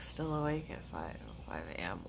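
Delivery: unvoiced whisper reading a comment; hushed whisper to herself. Real, not acted. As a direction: breathe the words out almost silent. Reading a late chat comment.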